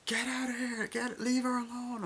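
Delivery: very high-pitched imitation of a yell; high mock cry. Character trying to save her, very high mock cry.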